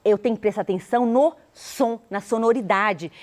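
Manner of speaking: ordinary delivery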